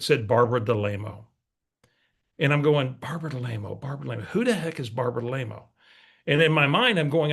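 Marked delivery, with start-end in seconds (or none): 3.0-5.6 quiet self-questioning under the breath; puzzled mutter to himself. Retold or imitated. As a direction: mumble it over, trying to place her. Puzzling over unknown caller name.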